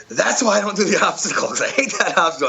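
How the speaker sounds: high, giggling told-you-so; laughing exclamation. Retold or imitated. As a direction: blurt it out mid-laugh after the shock. Retelling getting shocked at Operation.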